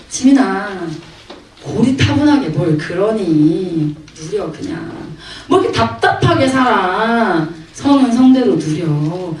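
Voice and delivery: slow, drawn-out coaxing; slow, wheedling mock persuasion; coaxing worldly tempter. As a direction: play the world luring a student, stretching each ending. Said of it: "세상은 계속해서 말한다".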